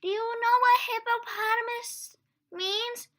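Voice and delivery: high, loud storybook voice; high character voice. Acted. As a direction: ask it in a bright high voice. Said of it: "Birch asked".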